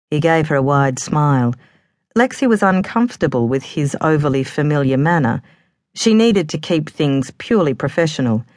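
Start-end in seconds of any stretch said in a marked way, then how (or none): none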